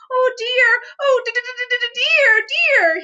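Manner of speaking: high, stuttering frightened squeak; stammering Piglet. A narrator's reading. Piglet clutching the string while stammering, high and afraid. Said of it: "he stammered".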